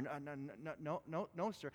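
soft, flustered stammer of denials; a caller caught off guard, stammering quietly. Retold or imitated. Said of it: "She started to stammer".

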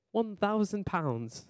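high, slow, hammed-up British accent; badly faked British accent; horrible British accent. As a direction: put on a bad British voice, drawn out. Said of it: "That was a horrible British accent".

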